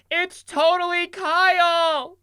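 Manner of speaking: high, slow, stretched-out scream; drunken fan screaming. Retold or imitated. Drunk student at [name] concert, screaming. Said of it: "I started screaming him out".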